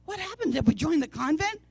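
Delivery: mock astonishment. Mock shock at strict schedule.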